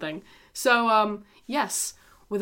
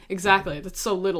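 ordinary talk, nothing marked

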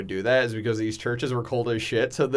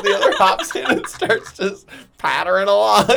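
ordinary talk

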